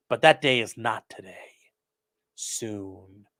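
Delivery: hushed mock-secret whisper; conspiratorial whisper.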